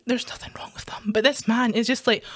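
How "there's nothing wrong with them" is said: soft, whispered protest; whispered aside. Real, not acted. Defending the cheap trainers in an aside, whispered. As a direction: drop to a whisper. Aside within her rant.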